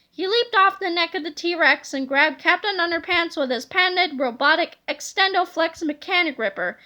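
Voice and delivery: plain reading voice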